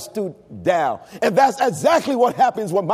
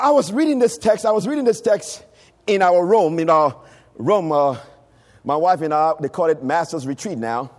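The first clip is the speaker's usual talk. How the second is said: normal talking voice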